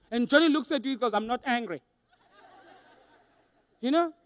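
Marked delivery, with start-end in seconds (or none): 1.1-1.8 soft, sullen mock denial; understated, tight-lipped grump; upset denial. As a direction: deny being angry while obviously upset. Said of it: "the tone is telling you that he's obviously upset".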